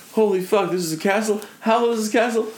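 gawk at the castle like an overexcited tourist; amazed tourist. Retold or imitated. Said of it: "he's just like".

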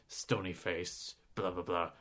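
low, slow and dead serious; grim mock monotone; stony-faced drone. Mocking the serious trailer.

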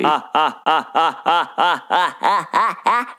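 a real burst of laughter. Real, not acted. Laughing at the alien replaying Billy's laugh.

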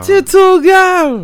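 high squeaky sung hook; high mock girl voice. Quoting a bashment tune.

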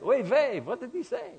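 put-on high sing-song Yiddish-accented shrug. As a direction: kvetch it like a Yiddish uncle. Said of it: "They probably said it like that".

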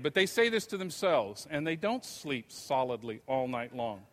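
ordinary delivery

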